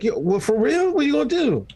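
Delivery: high, teasing mock concern; mock concerned reply. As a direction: egg him on with fake concern. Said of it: "I was like".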